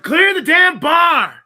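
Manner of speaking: high, loud, slow drawn-out yell. Acted. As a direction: bellow it at the guard. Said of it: "I yell at the guard".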